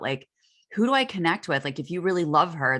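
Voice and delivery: ordinary talk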